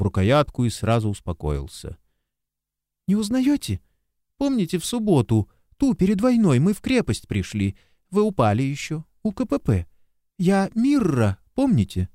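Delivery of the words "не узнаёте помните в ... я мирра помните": put-on much higher, eager young woman; young woman's voice. A narrator's reading. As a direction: remind him eagerly, hopeful. Mirra reminds him eagerly.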